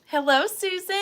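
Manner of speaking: bright called greeting; calling out hello. Real, not acted. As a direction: call out across the room. Calling out to a viewer.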